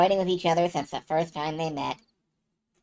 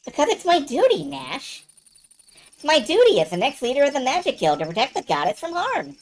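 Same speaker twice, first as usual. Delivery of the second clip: high, insistent voice of a dutiful heroine; Mia; 6 semitones higher than usual. Acted. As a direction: declare duty with raised, earnest pitch.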